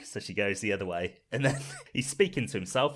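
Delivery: ordinary delivery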